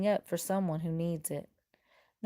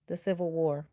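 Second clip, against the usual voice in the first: ordinary delivery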